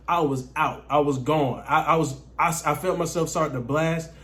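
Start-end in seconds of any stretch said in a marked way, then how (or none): none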